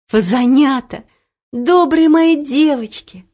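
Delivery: overjoyed to have them back; joyful exclamation. A narrator's reading. Auntie embracing the girls, joyful exclamation. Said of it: "Как радостно обнимала Олю и Ело".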